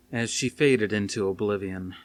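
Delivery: ordinary delivery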